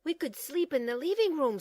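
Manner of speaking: eager. Acted. Franklin eager suggestion.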